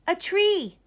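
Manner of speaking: put-on high, loud boy's reply; high child answer. Ned answers the teacher about his lion. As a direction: answer proudly like a small boy. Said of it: "Ned replied".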